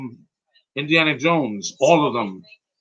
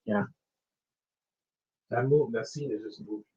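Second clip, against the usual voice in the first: choked up. Real, not acted. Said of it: "before I start leaking here".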